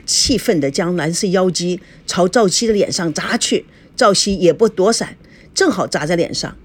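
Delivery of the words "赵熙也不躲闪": plain reading voice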